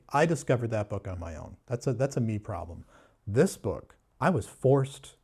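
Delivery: normal talking voice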